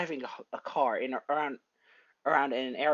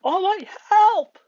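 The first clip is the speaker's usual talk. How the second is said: drawn-out, high, loud cry for help; yelling for help; 11 dB louder, slower than usual. Acted. Izuku pulled into the fog calls his mentor, desperate yell. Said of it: "Izuku yelling".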